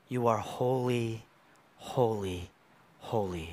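slow, hushed, awed chant; reverent angels. Retold or imitated. Angels to God, slow hushed awe. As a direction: breathe holy in reverence. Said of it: "what do they say?".

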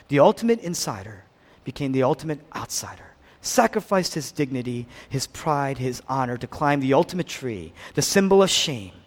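ordinary delivery

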